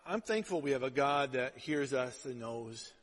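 ordinary talk

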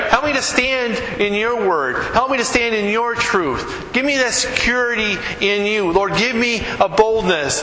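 high-pitched, insistent pleading; acted prayer. Believer asking the Lord for boldness, high earnest acted plea. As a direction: plead upward for courage, line by line.

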